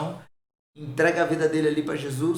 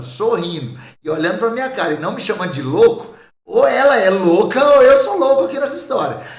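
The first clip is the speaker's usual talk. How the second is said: high, loud burst of disbelief; half laughing at how crazy it sounds; exclaiming in disbelief; 5 semitones higher, 10 dB louder than usual. Retold or imitated. Man tells friends about a strange night, exclaiming in disbelief. Retold disbelief at woman's reaction.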